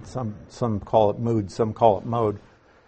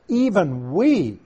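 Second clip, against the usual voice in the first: "even we" raised high and stretched slowly; emphasis on EVEN WE; 5 semitones higher, slower than usual. Shown as a demonstration. Teacher showing how "even" adds emphasis, "Even we" high and slow. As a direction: lift and draw out "even we". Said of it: "adds more emphasis".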